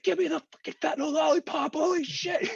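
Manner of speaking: very high, frantic falsetto begging; mock desperate plea. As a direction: re-enact screaming for pain relief. Re-enacting his desperate plea for painkiller.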